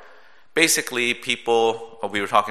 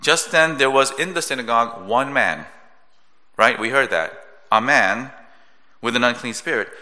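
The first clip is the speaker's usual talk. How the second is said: ordinary delivery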